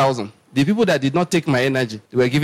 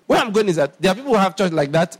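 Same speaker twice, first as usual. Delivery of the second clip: ordinary delivery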